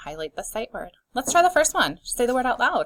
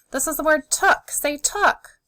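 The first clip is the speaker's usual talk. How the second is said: usual speaking voice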